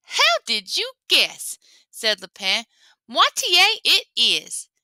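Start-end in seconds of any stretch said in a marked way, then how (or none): 0.0-1.6 high, slow, coy trickster; sly rabbit. Acted. Lapin sly reply. 3.1-4.7 high, slow, savoured reply; sly rabbit. Acted. Lapin names baby Halfway.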